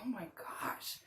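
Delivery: hushed awed whisper. Retold or imitated. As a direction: breathe it out in awe, no voice. Awed self-realization quote.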